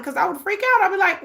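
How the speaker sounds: normal talking voice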